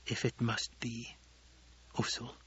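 hidden tears. A narrator's reading. Chani to Paul, quietly hiding her tears. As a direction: swallow the sorrow. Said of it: "the secret tears in her voice".

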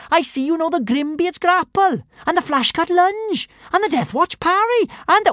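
high-pitched running commentary; chattering little girl. A narrator's reading. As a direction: chatter on keenly in a high voice, heedless of interruption. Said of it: "carried on talking".

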